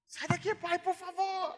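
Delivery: put-on high, loud child's wail at dad; upset daughter. Daughter in tears telling her father to leave, high wailing plea. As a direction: shoo dad out like an upset little girl.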